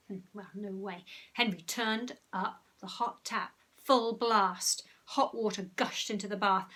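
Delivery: lively storybook reading; story narration. A narrator's reading. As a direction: read it like a bedtime story. Reads story to children.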